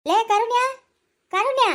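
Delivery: loud, high call across the house. Acted. Grandmother calls her granddaughter over from another room, loud high call. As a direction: call her name so she hears from far.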